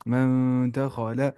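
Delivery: slow hummed "من" into "دا خا لا"; nasal ikhfa. Shown as a demonstration. Teacher adds a syllable, slow nasal ikhfa. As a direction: draw "من" out through the nose. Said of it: "جو کہ حرف اخفا میں سے".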